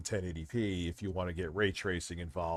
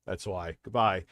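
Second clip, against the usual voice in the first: ordinary talk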